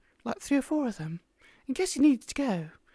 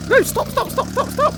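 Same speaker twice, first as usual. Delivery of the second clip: high frantic stop stop stop; panicked shouting; 9 semitones higher, 6 dB louder than usual. Real, not acted. Panicked as car crashes.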